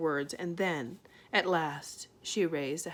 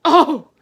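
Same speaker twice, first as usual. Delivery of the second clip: shocked gasp; 9 semitones higher, 21 dB louder than usual. A narrator's reading. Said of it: "gasped Homily".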